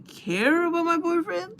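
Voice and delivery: loud, high-pitched challenge; retold outraged question, pitch jumping up; indignant. Woman confronting friend about her boyfriend, indignant. Acted quarrel over the boyfriend.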